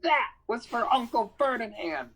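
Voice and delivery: yelled back. Acted. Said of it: "Grover yelled back".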